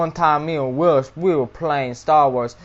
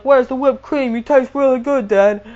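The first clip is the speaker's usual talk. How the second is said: much higher, giddy and loud; gleeful high squeal; 10 semitones higher, 4 dB louder than usual. Acted. Teller to his dad in the story, giddy squeal. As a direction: squeal it like a kid tasting dessert. Savoring cannibal dad joke.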